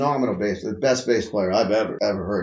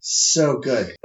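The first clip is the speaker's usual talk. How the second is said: normal talking voice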